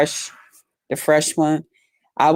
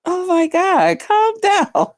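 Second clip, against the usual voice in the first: much higher, laughing protest; laughing at friend; slower than usual. Retold or imitated. Telling her friend to calm down, high and laughing. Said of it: "I'm like".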